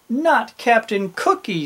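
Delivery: put-on boy's protest. A narrator's reading. Jim defending his favorite gingerbread man, protesting. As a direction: protest it, pitched well up.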